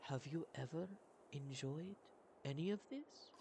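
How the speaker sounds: soft, hesitant question with long pauses. Acted. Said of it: "Sighorn speaks again, quieter".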